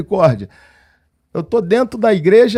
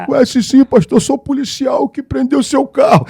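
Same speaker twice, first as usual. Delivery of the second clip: tearful, raised and higher; crying confession; 6 semitones higher than usual. Retold or imitated. Policeman confessing he seized the car, tearful outburst. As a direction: blurt it out through tears. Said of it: "Ele já começou a chorar".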